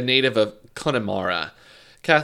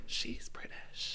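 slow, hushed stage whisper; imitated awed whisper; 16 dB quieter, much slower than usual. Imitating girls noticing a British shopper, whispering. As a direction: whisper it slowly, as if amazed.

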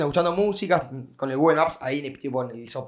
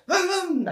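high revving engine noise made by mouth; imitated motorbike revving; 12 semitones higher than usual. Storyteller imitating the boyfriend's bike a block away, revving. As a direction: mimic repeated twists of the throttle. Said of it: "Así acelerando".

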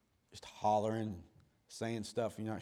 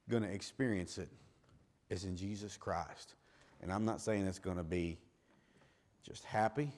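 ordinary talk, nothing marked

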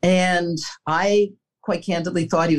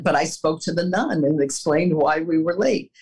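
normal talking voice

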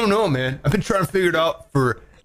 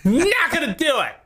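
whiny spoiled pouty drawl; 12 semitones higher, much slower than usual. Retold or imitated. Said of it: "your brain's like".

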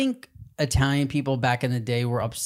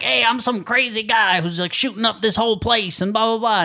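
higher, lightly put-on crazed voice; mock unhinged villain; crazy gunman character; 7 semitones higher than usual. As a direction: play a wild shooter, hammed up. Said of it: "He plays, like".

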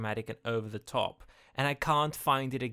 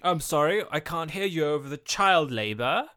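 loud, high pretend politeness with a laugh; mock can't-hear-you; 5 semitones higher, 7 dB louder than usual. Playing a company brushing off the objector, mock can't-hear-you. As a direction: call over an imagined din, playfully pitched up.

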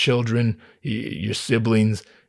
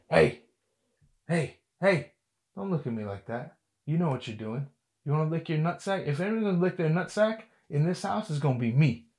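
scolding the dog; 4 semitones higher, 8 dB quieter than usual. Real, not acted.

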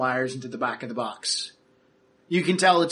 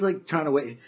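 usual speaking voice

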